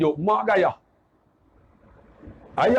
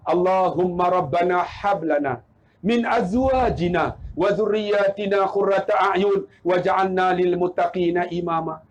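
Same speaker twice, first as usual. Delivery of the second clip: lower, measured Arabic supplication; recited prayer; 5 semitones lower than usual. Retold or imitated. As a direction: recite it as a father's private prayer. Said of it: "Apa doa dia?".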